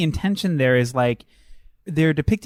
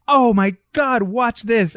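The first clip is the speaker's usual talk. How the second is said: higher-pitched gleeful quote; mock excitement; 6 semitones higher than usual. Voicing the woman as the first guy comes in, excited. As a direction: play her eagerly showing off.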